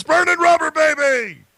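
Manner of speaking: high-pitched rallying holler; yelled catchphrase. Shown as a demonstration. Host cueing the guest for the sign-off, yelled. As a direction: holler it high, falling off at the end. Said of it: "Let's hear those three famous words".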